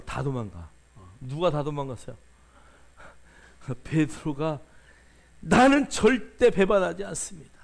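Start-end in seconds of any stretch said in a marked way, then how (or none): 5.4-7.5 loud, proud vow with the stress on 나는; Peter's loud boast. Retold or imitated. Peter swearing loudly to Jesus, puffed-up loud vow. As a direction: bellow 나는 like a boastful disciple swearing loyalty. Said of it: "큰 소리 치고".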